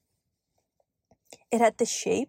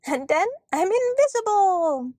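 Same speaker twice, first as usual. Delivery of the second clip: disembodied elf voice; 9 semitones higher than usual. A narrator's reading. Elf vanishing before girl, playfully showing off. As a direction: stretch the last word high like a vanishing sprite.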